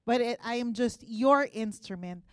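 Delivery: quiet, reverent prayer ending softly; closing prayer. Acted. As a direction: hand the service over to God, hushed.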